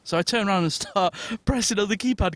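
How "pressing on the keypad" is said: normal talking voice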